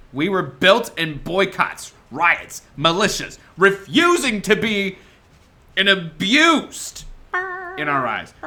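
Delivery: rousing, building political speech; rally the people to rise up; stirring speech. Acted. Impassioned closing speech.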